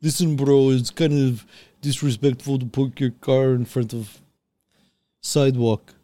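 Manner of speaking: put-on Ukrainian complaint voice; deliberate, heavily accented Ukrainian imitation; mock Ukrainian accent. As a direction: imitate a Ukrainian neighbour scolding you. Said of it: "they're Ukrainian".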